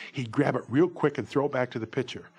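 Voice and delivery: ordinary talk, nothing marked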